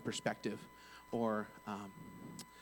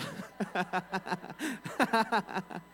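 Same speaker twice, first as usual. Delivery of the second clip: long, high burst of laughter; real uncontrolled giggling fit; hearty laugh; 8 semitones higher, 4 dB louder than usual. Real, not acted. Speaker cracking up at his own story, laughing hard.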